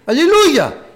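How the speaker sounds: loud high cry of praise; exultant shout. Real, not acted. Preacher shouting hallelujah to the congregation. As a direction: burst out in triumphant praise.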